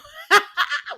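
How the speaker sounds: loud squealing laugh; real high laugh. Real, not acted. Vlogger cracking up at her own soap recap, high laugh.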